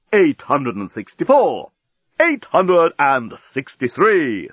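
slow, measured countdown announcement; talking bomb counting down. Acted. Talking bomb announcing its countdown, slow and ceremonious. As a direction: draw each number out with ceremony. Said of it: "said the bomb".

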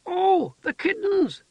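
very high, slow excited exclamation; delighted childlike cry. A narrator's reading. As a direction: burst out like a child remembering. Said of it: "she shouted".

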